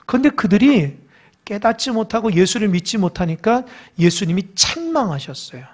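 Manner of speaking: ordinary delivery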